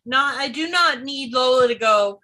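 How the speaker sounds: ordinary talk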